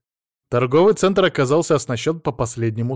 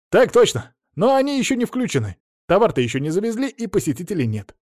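high-pitched dutiful report; high, obedient guard voice; eager subordinate guard; 8 semitones higher, 4 dB louder than usual. A narrator's reading. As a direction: report smartly to a superior, voice pitched up. Guard reporting smartly to superior.

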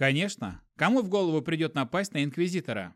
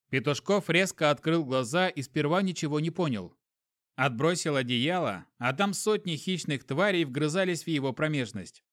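ordinary delivery

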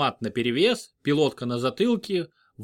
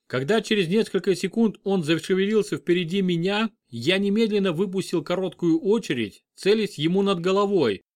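ordinary delivery

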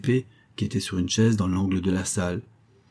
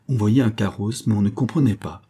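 usual speaking voice

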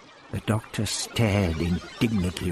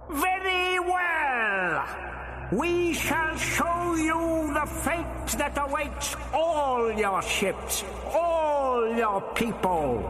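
very high, very slow shouted rage; raging alien boom; much slower than usual. A narrator's reading. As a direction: scream the threat at a terrifying pitch. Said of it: "The voice rose to a terrifying pitch".

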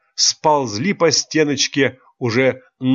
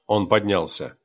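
ordinary delivery, nothing marked

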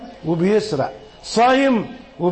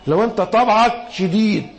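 usual speaking voice

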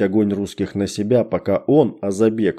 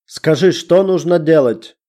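eager, higher and louder plea; fervent; 7 semitones higher, 4 dB louder than usual. Acted. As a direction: beg eagerly, heart in it. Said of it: "с жаром проговорил Амирхан".

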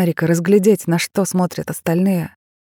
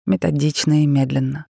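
plain reading voice

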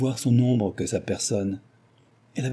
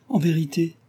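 raised, mildly agitated protest; with emotion; 7 semitones higher than usual. A narrator's reading. Cécile protests her innocence to Delville, with emotion. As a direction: exclaim it higher, with feeling. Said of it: "s'écria-t-elle avec émotion".

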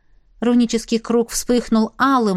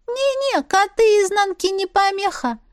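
much higher, light fairy voice; high little sprite voice; 11 semitones higher than usual. Acted. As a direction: voice a tiny magical creature. Голос маленькой Искры. (высокий голосок феи)

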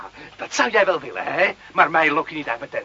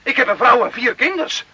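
higher, urgent pleading; desperate pleading; 6 semitones higher, 4 dB louder than usual. Acted. Carlo pleads with the lieutenant for his family, desperate. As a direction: beg the lieutenant to let you go. Carlo begs not to be arrested.

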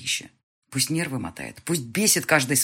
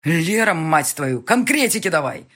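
shouting; 3 semitones higher, 7 dB louder, slower than usual. Acted. Hero yelling into phone.

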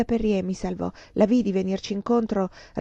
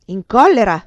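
explosive, much louder outburst; furious incredulous shout; angry yell; 3 semitones higher, 11 dB louder than usual. A narrator's reading. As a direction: yell it in outraged disbelief. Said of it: "urlò lui".

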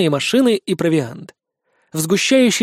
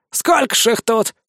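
higher, timid wondering voice; nervous vampire; 5 semitones higher than usual. A narrator's reading. Vampire eyes the dragons, high and uneasy. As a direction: shrink into it, uneasy. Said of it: "втянул голову упырь".

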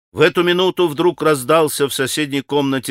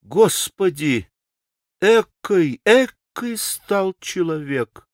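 pained, pitched-up exclamation; shamed dismay; 4 semitones higher, slower than usual. A narrator's reading. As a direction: lament in disbelief, drawing the words out. Grushenka's shamed dismay at him.

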